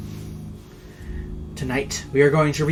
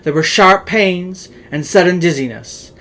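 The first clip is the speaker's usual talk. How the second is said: louder, theatrical reading of a gothic tale; dramatic story narration; 3 semitones higher, 4 dB louder than usual. A narrator's reading. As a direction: tell it like a ghost story by candlelight. Reads the Poe tale aloud.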